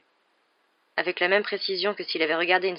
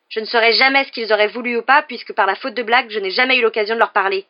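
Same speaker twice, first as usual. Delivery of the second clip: loud, sharp, raised-voice comeback; curt retort; 4 semitones higher, 10 dB louder than usual. A narrator's reading. Harry answering Hermione about his dead parents, curt retort. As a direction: retort sharply, hitting the first words hard. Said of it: "répliqua sèchement Harry".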